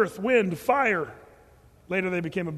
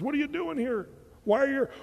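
raised, high-pitched retold question; probing question; 7 semitones higher than usual. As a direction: ask it high, pressing for a reason. Voices the Lord questioning the mumbler.

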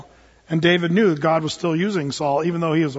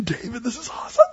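eager soldiers; 6 semitones higher than usual. Retold or imitated. David's men urging him to strike the sleeping Saul, excited. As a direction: egg him on to kill the king. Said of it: "his guys are saying".

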